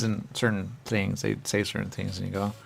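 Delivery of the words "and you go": ordinary talk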